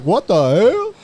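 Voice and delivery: surprised exclamation. Retold or imitated. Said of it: "He's like".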